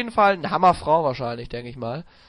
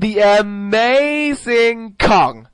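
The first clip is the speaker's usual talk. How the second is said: slow, louder announcer call; ring announcer voice; 5 semitones higher, 5 dB louder, much slower than usual. Pretend. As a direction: stretch the name like an arena introduction. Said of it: "Jeremy Borash sagt das Match an".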